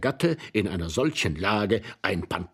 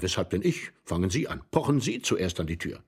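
ordinary delivery, nothing marked

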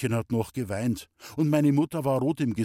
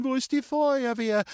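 panicked scream; 13 semitones higher, 3 dB louder than usual. A narrator's reading. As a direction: scream it out as the frightened mother. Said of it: "hat meine Mutter geschrien". (entsetzt aufgeschrien, hoch und gedehnt)

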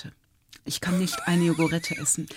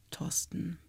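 whispered; 3 semitones lower, 13 dB quieter than usual. Real, not acted. Teasing aside after punchline.

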